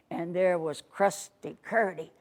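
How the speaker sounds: usual speaking voice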